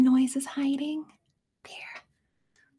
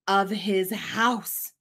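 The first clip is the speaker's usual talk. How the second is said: ordinary delivery, nothing marked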